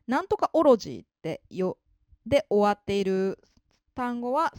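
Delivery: usual speaking voice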